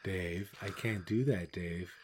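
slow, quiet, calm machine voice; imitating a calm ship computer refusing, slow and hushed; movie computer quote. Quoting Space Odyssey to a co-host, slow hushed computer voice. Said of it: "the Space Odyssey".

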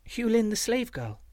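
put-on high-pitched little-girl voice; simple, unadorned child's answer; child, simply. A narrator's reading. Slave girl introduces herself to the man, simple child voice. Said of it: "said the child simply".

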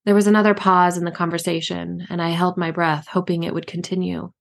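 ordinary delivery, nothing marked

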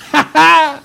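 loud, high-pitched staccato laugh; acted high "ha-ha-ha"; high cackle. Storyteller laughs after the last hello.

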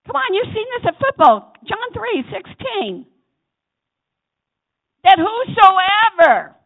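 high, loud preacher's cry; fiery preaching. Real, not acted. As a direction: whip the congregation up. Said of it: "preaching at full force".

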